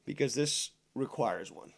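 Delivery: talking around a cigarette; cigarette in mouth. Real, not acted. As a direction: speak with a cigarette between lips. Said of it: "let me light a cigarette".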